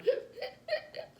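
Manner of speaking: quick wordless gasps, acting out panic; imitated hyperventilating. Woman showing how she couldn't breathe, imitated hyperventilating. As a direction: mimic short panicked breaths. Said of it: "I'm just so scared".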